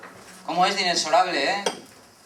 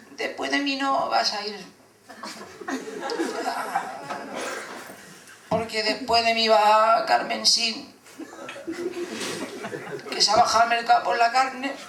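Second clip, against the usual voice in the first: put-on elderly waiting-room lady; 4 semitones higher than usual. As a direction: drag the words out in a high old-lady voice. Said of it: "esa señora cobra vida. Y te habla".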